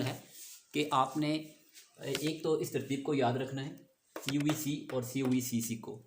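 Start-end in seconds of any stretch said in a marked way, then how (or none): none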